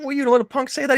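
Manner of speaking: high, incredulous retold question; mock disbelief, high; imitated goading. Acting out De Niro egging on.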